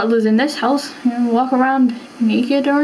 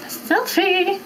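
bubbly selfie squeal; 8 semitones higher, 3 dB quieter than usual. Pretend. Posing for a selfie.

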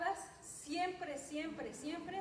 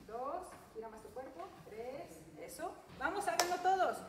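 usual speaking voice

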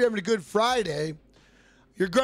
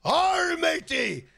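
hammed-up shouted pirate, high and slow; goofy pirate shout; mock pirate; 6 semitones higher, slower than usual. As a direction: growl it like a cartoon pirate. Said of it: "some pirate type shit".

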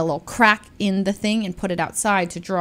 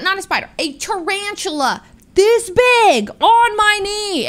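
normal talking voice

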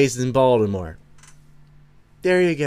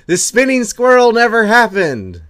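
high, loud, grand declaration of "The spinning squirrel never happened."; mock proclamation; 11 semitones higher, 9 dB louder than usual. Streamer reading a viewer's line on request, loud and high. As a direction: proclaim it like a herald, big and high. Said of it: "Say it".

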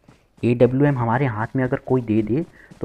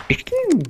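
very high imitated gunshot ping; gunshot noise. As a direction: make the bullet sound with your voice. Imitating sniper shot.

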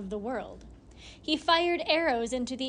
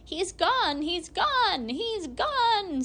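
shrill rising screams, each louder and higher; screaming hag; 7 semitones higher, 3 dB louder, much slower than usual. A narrator's reading. Hag finds the cradle empty, screaming. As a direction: hag of the house screaming that Gilly is gone. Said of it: "screamed the hag of the house".

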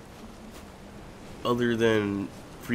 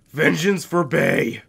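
dramatic vow; 7 semitones higher, 5 dB louder, slower than usual. Acted. Vows revenge for killed pet.

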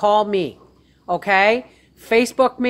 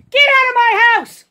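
panicked shout; 12 semitones higher, 5 dB louder than usual. Real, not acted. Yelling at animal in house.

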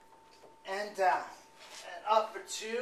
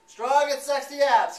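louder and higher hype; energetic cheer; 8 semitones higher, 9 dB louder than usual. Real, not acted. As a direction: pump them up mid-set. Coach cheering while exercising.

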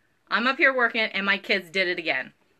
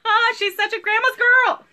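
high, louder cooing voice; 11 semitones higher, 5 dB louder than usual. Real, not acted. Cooing at viewer's grandma call.

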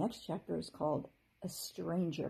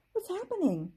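worried fairy; 11 semitones higher than usual. A narrator's reading. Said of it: "she sounded worried".

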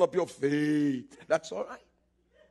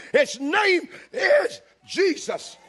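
preaching shout; 11 semitones higher, 6 dB louder, much slower than usual. Real, not acted. Climax of the sermon.

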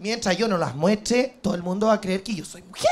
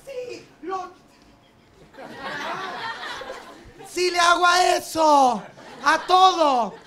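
higher, slow, laughing yes to everything; eager agreeing; 6 semitones higher, much slower than usual. Retold or imitated. Answering the guy's questions at the disco, eager high laughing yes. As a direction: gush yes to it all. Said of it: "¿tus papás están vivos?".

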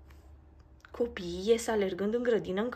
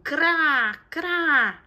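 a sharp, loud crow call rising high; loud crow caw; 8 semitones higher, 11 dB louder than usual. A narrator's reading. Jackdaw shrieks loudly across the garden, a high loud caw. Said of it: "atât de puternic".

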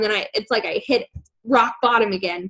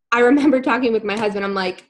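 ordinary talk, nothing marked

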